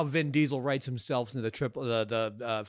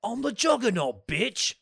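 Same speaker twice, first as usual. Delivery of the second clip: high, hammed-up movie-villain boast; mock Juggernaut; 9 semitones higher, 4 dB louder than usual. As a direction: belt out a villain catchphrase. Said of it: "Vinnie Jones wore".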